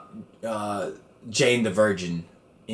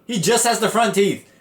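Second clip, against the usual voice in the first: higher scolding mom voice; imitating mom; 10 semitones higher than usual. Mom scolding him about raw carrots, mock scolding. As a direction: lecture like a flustered mother. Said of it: "And she's like".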